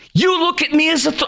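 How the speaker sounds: high-pitched indignant outburst; real anger. Real, not acted. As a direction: pitch jumps up in offended disbelief. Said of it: "I'm sorry, I'm mad".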